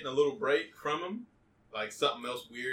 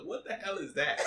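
shocked; 8 semitones higher than usual. Retold or imitated. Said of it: "I was like".

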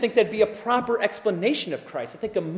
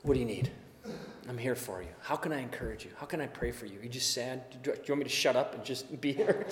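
kneel beside someone sad and speak softly; tender hushed comfort; 8 semitones lower, 14 dB quieter than usual. Retold or imitated. Kneeling by a crying person in the corner, tender hushed comfort.

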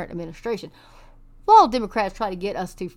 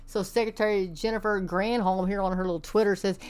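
usual speaking voice

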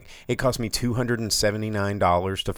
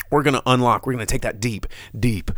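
wrestling promo; 3 semitones higher than usual. Pretend. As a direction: cut a promo like a pro wrestler. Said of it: "You cutting a wrestling promo about the review?".

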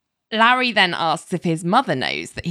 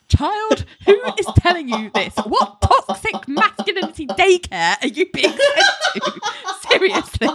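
much higher, faster, laughing exasperation; laughing outrage; 13 semitones higher than usual. Retold or imitated. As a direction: scold a kid in mock horror through laughs. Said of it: "And I was like".

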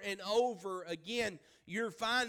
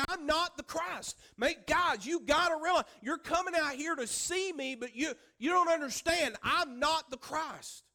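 high, loud urgent plea; pleading shout; 7 semitones higher, 5 dB louder than usual. Retold or imitated. John urging the crowd who came to see him, loud and pleading. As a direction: press it on them hard.

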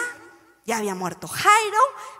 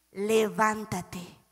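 slow command; 4 semitones lower, 6 dB quieter, much slower than usual. Acted. Jesus raising the girl.

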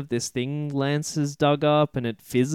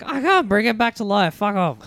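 witch voice; 6 semitones higher than usual. Retold or imitated. Witch refuses to revive son.

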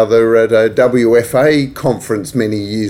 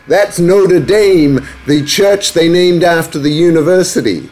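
bad American accent; 6 semitones higher than usual. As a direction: put on an exaggerated, badly faked American accent. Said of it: "that's a bad American accent".

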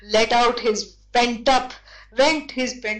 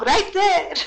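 laughing reveal; 6 semitones higher than usual. Real, not acted.

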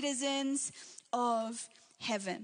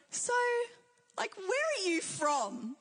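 drawn-out, higher-pitched mock puzzlement; curious questioning; 7 semitones higher, slower than usual. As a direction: imitate a puzzled stranger asking, slowly. Imitating puzzled strangers asking her origin.

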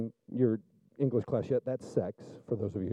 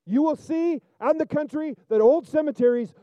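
higher and louder, soaring sermon voice; preaching at full force; 10 semitones higher, 7 dB louder than usual. Acted. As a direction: preach it loud and fervent to the congregation.

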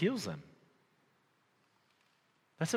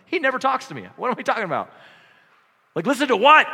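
much higher and louder, comic frustration; mock exasperation; 11 semitones higher, 11 dB louder, faster than usual. Preacher complaining he hears nothing in silence: mock exasperated outburst. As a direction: throw up your hands, much higher and louder. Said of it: "I'm like".

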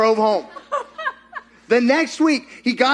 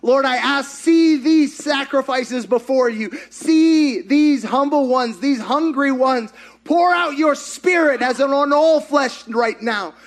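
raised, slow prayer with swells of volume; rising, urgent intercession voice; fervent prayer; 5 semitones higher than usual. Real, not acted. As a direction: plead over the bowed congregation.

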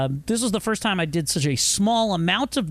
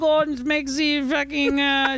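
put-on high hammy German accent; bad German accent; 7 semitones higher than usual. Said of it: "do the worst German accent".

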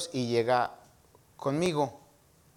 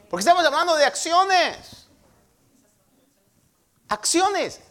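loud, high cry to the congregation; emphatic preaching shout; 12 semitones higher, 7 dB louder than usual. Real, not acted. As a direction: proclaim it at full voice, driving the key word home. Preacher drives home key word.

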